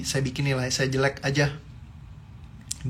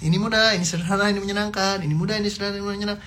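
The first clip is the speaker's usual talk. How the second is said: higher, faster mock mantra; imitating the mother; 7 semitones higher, faster than usual. Imitating the mother drilling her child with the mantra, quick and higher. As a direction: rattle it off like the mom drilling it. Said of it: "diinin sama mamanya, diulang-ulang terus".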